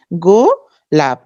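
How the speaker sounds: গোলাপ very slowly as "go... lap"; split into syllables. Shown as a demonstration. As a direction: hold the first syllable of গোলাপ, then pause. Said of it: "এখানে দুইটা অক্ষর".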